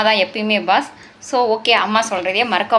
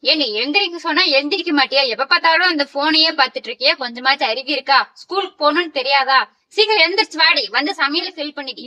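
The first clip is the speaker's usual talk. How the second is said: scolding mother; 2 semitones higher than usual. Acted. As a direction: nag like a fed-up mother. Mother scolds daughter to get up. (எரிச்சலோடு திட்டும் அம்மா)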